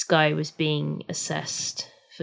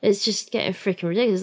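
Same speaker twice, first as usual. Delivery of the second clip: ordinary talk, nothing marked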